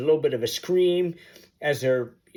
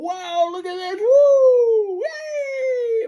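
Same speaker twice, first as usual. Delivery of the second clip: much higher, squealing woo and yay; playing up excitement; 4 dB louder, much slower than usual. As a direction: ham it up to make a scared kid laugh. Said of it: "we played up".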